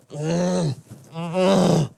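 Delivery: ordinary delivery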